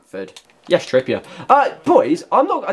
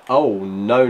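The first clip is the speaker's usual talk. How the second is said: ordinary talk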